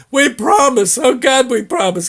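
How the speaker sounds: high, pleading mock whine with a laugh; imitating begging. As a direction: plead like a cornered tough guy, half laughing. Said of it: "They're begging him".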